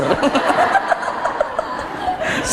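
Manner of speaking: breathless rising laugh; airy laugh. Real, not acted. Preacher laughing at his own story. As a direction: laugh out softly.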